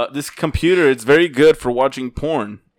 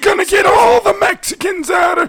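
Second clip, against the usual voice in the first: zealous Trump fan; 4 dB louder than usual. Acted. Mock Trump fan rant.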